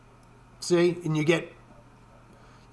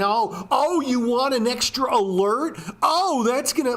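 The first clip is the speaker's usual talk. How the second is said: higher, sing-song mock upsell voice; mocking salesman; 6 semitones higher than usual. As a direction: parody the platform's fake-friendly pitch. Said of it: "nickel and diming me for fucking".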